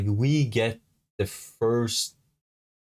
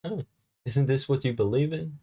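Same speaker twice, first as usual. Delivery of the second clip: mocking quote; 6 semitones higher than usual. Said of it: "I think people are gonna be like".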